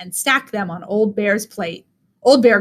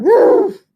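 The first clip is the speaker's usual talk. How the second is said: loud, high animal woof; a big hammed-up bear woof; bear roar; 10 semitones higher, 6 dB louder than usual. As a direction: bark it out like a startled bear. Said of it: "Old Bear roared".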